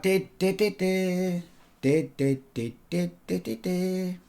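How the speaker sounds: little chanted de-de tune; imitated music. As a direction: hum it like a toy melody. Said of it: "They kept going like".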